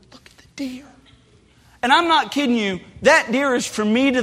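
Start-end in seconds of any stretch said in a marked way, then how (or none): 0.1-0.8 breathless hushed whisper. Retold or imitated. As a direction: whisper so the deer won't bolt. Hushed so deer won't flee.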